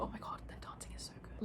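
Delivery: hushed, whispered gushing; awed whisper. Real, not acted. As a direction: drop into a whisper of admiration. Awed aside about their dancing.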